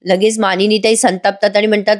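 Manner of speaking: plain reading voice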